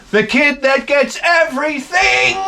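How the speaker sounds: hammed-up jealous whine; mock whiny. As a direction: pout about the spoiled kid. Jealous about the spoiled kid.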